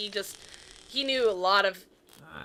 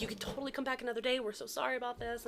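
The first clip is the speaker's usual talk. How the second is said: softer, higher, hurried apology; profusely apologizing; 5 semitones higher, 7 dB quieter, faster than usual. Retold or imitated. Park staff reassure the disappointed family, apologetic. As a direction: gush the apology quickly and quietly. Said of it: "profusely apologizing".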